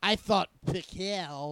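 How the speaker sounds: heavy mock accent on Picard, drawn out; overdone regional accent. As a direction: lay the accent on thick, slowly. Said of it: "I think you might be overdoing the accent".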